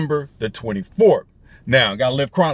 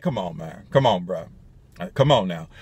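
deep, falling protest; bassy, man-to-man brush-off; low-voiced rebuff; 8 semitones lower, 4 dB quieter than usual. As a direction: drop low to wave off the question. Bassy rebuff of vetting passengers.

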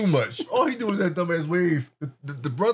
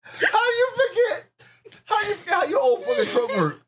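higher, laughing half-crying shout; laughing disbelief; 11 semitones higher than usual. Real, not acted. Host laughing in disbelief at the villain forgetting his truck, higher and shouting. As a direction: howl at how dumb it is.

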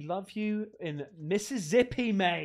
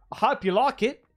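louder, broad Australian "I hope you like it."; hammed-up Aussie; 2 semitones higher, 6 dB louder than usual. Shown as a demonstration. Streamer re-reads a fan's note in a broad Australian accent. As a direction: lean into the Aussie twang on "like". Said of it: "so it should be like".

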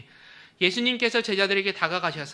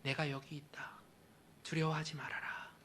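soft, hushed comfort; tender whisper; 3 semitones lower, 12 dB quieter than usual. Acted. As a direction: gently reassure them. (예수님이 속삭이듯 다정하게 위로하며)

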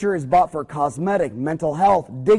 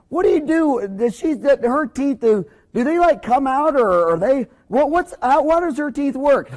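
lightly voiced husband, higher and halting; imitated baffled husband; 6 semitones higher than usual. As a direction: stumble through an awkward question as the husband. Acted husband hesitantly asks about teeth.

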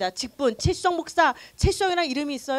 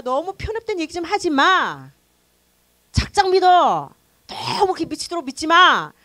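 louder, slow exasperated scolding; scolding family; 5 semitones higher, 7 dB louder than usual. Retold or imitated. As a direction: nag like fed-up relatives. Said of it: "가족들한테 들었던 얘기였어요". (가족이 답답해하며 나무라는 말투)

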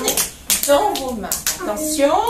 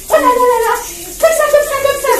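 excited cheering; 6 semitones higher, 6 dB louder, faster than usual. Acted. Rubbing hands, cheering children on.

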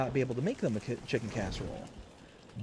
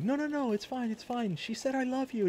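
excited reassurance; 13 semitones higher than usual. Retold or imitated. Reassuring wife in retold story.

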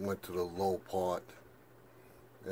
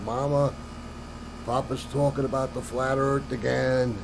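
higher, louder mock tattling call to Mama; retold yell; 4 semitones higher, 4 dB louder than usual. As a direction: imitate a grandson yelling for his mother.

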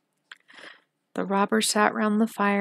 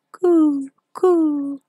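pigeon cooing; 6 semitones higher, 5 dB louder than usual. Acted. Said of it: "Then the wood pigeon said".

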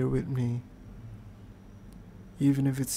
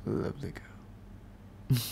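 soft slow whisper; 2 semitones lower, 8 dB quieter, much slower than usual. Real, not acted. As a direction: breathe it out tenderly. Tender whisper to girlfriend.

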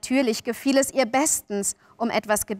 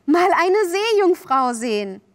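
high, loud longing; thrilled wishing out loud; delighted cry; 8 semitones higher, 5 dB louder than usual. A narrator's reading. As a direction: exclaim it as a dream come true. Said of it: "rief Wendy".

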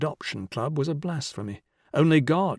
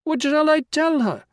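high, loud panicky wail; 6 dB louder than usual. A narrator's reading. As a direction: wail it after them in comic terror. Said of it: "he wailed at their heels".

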